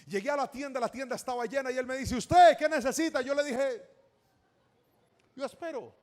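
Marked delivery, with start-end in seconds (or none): 2.2-3.2 loud, high demand; brusque shopkeeper. Acted. Shopkeeper to boy in crowded store, brusque. 5.3-5.9 high, softer little reply; shy boy. Acted. Boy avoiding notice in the full shop, shy.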